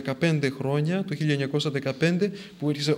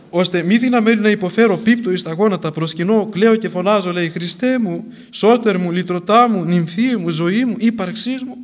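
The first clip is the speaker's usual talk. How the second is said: louder, rising cries to Christ; fervent prayer; 4 semitones higher, 8 dB louder than usual. Acted. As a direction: cry out each name of Christ. Fervent quoted prayer cry.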